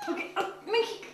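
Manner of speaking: high laugh through the words; pained laugh. Real, not acted. Owner laughs at the clawing cat, high and breathy. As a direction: laugh it off after the scratch.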